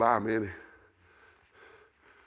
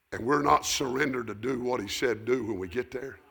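ordinary talk

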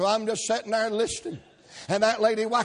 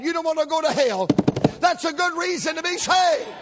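loud high sermon shout; preaching shout; 6 semitones higher, 3 dB louder than usual. Real, not acted. Preacher shouting at his congregation, loud.

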